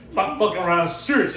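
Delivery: loud and firm warning; stern shout. Retold or imitated. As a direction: raise the voice, scold hard. Clone scolding his buddy.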